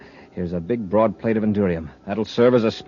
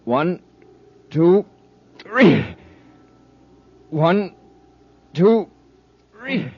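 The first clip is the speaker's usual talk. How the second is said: counting cadence; 8 semitones higher, 5 dB louder, much slower than usual. Acted. Counting the throws in rhythm.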